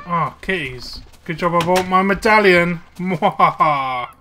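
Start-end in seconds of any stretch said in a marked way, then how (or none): 3.0-4.1 slow evil cackle; villainous mwahaha laugh; mad scientist. Acted. Laughs after medallion remark.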